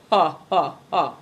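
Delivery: spoken-out "ha, ha, ha"; deliberate, spaced story-villain laugh; mock laugh. A narrator's reading. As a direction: laugh in separate, acted syllables.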